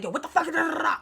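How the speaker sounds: mild mock tirade trailing into nonsense syllables; mock cursing out. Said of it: "cursing at you".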